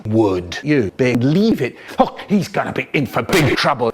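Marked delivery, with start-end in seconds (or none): none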